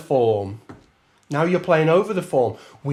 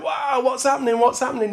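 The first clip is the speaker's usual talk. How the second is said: surprised band; 8 semitones higher, faster than usual. Retold or imitated. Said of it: "the rhythm section will be like".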